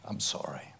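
low whispered "I'm sorry"; whispered apology. Shown as a demonstration. Preacher showing the congregation how to apologize, whispered. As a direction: hush it like a confession.

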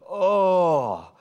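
sudden loud prayerful groan; demo groan. Shown as a demonstration. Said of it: "where you're just literally groaning".

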